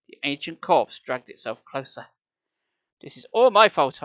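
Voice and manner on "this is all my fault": higher, louder distressed confession; a touch of panic, voice raised; trembling voice. A narrator's reading. Boy blaming himself as the mummy nears, trembling. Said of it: "I declared in a trembling voice".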